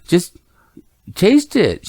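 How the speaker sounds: put-on high-pitched pleading with a crying child; exasperated parent. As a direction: imitate a baffled dad coaxing his kid. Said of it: "And then she starts crying".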